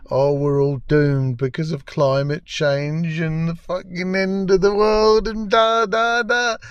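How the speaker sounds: whiny rant climbing steadily in pitch; mock doom-mongering. Mocking the writers' climate message, rising mock lament. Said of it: "is this the writers".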